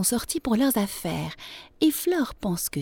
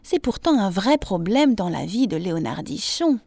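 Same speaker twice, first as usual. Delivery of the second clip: plain reading voice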